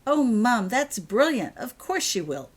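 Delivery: high, loud, bursting with joy; a girl thrilled by a clever plan; delighted. Acted. Daughter thrilled at mum's bag idea, delighted. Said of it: "sunniest smile".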